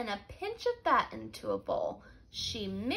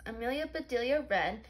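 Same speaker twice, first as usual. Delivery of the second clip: plain reading voice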